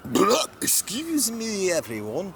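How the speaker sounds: high, loud burp and polite apology. Acted. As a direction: belch then apologise grandly. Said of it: "he's like".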